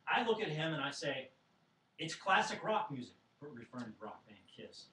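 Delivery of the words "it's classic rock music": put-on louder, higher kid's objection; indignant protest. Eight-year-old in Kiss makeup to his father, indignant protest. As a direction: insist on it, offended.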